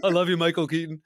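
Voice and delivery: over-eager mock adoration; gushing fan. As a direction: blurt it out like a starstruck fan. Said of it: "So you're not just like".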